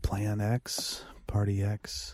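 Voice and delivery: breathy hushed whisper. Real, not acted. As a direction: whisper it to yourself while remembering. Whispering the half-remembered title.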